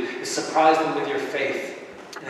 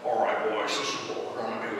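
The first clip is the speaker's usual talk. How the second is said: lightly imitated Southern drawl, low and softer; 6 semitones lower, 5 dB quieter than usual. Preacher imitates his gaming platoon leader, light Southern drawl. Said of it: "most awesome Southern drawl".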